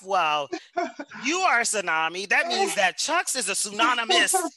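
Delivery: high, loud thrilled outburst; bursting with delight at her own wordplay; excited. Retold or imitated. Host marvels at the guest's wife's joke, excited and loud.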